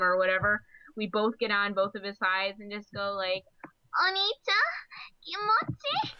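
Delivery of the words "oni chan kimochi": high, breathy-free cutesy anime sister voice; little sister voice. Acted. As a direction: coo it like a clingy little sister. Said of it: "I have the best little sister voice".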